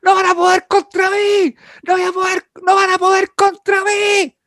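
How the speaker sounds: high, loud, slow yelled defiance out the window; retold yelling. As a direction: yell it out the window at three in the morning, dragging the words. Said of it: "Yo estaba gritando por la ventana".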